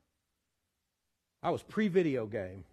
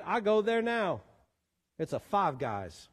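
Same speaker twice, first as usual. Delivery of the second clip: ordinary talk, nothing marked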